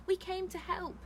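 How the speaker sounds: high-pitched pleading; earnest protest. A narrator's reading. Chaya to the accusing woman, high-pitched protest. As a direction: plead your case in a high voice.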